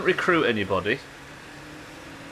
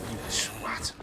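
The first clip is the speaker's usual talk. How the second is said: whispered insult; 8 dB quieter than usual. Real, not acted. Muttered insult after a failed move.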